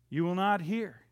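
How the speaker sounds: normal talking voice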